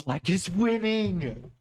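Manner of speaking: drawn-out disbelief; stunned. Real, not acted. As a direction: let the shock land on winning. Stunned at the chess position.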